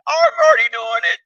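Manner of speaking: high, bright squeal of disbelief; excited squeaky protest. Retold or imitated. Protesting the prophecy, high excited squeal. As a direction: blurt it out, thrilled and amazed.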